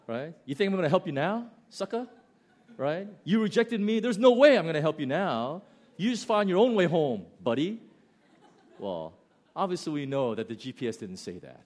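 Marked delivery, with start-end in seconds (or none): 0.4-2.1 high, loud, quick taunt; mock taunting. Spurned GPS to returning driver, loud mocking taunt. 3.2-7.8 loud, high, hammed-up outrage; mock indignation. Spurned GPS to lost driver, loud mock outrage.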